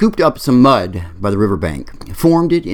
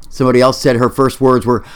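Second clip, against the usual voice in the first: normal talking voice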